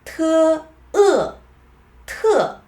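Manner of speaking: first "Te" high and level, then "e, te" dropping; falling tone. Shown as a demonstration. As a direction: spell out "Te, e, te" with a sharply falling final. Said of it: "it's falling".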